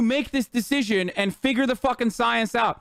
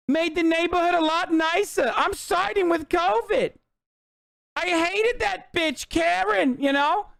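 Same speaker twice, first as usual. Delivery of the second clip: higher-pitched put-on character accent, matter-of-fact griping; pro-covid Karen; 6 semitones higher than usual. Siding with covid against a hated neighbor, pro-covid Karen voice. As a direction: play a spiteful neighbor in a high, accented voice. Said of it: "The pro-covid Karen?".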